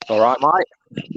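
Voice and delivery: lightly put-on Australian greeting; Australian accent. Trading accents with friends online, mock Australian accent. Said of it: "what kind of accent I was doing in Australian".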